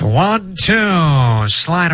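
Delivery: high vintage radio pitch call; snappy old broadcaster call; old-timey radio announcer. Pretend. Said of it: "I'm gonna do an old-timey radio voice".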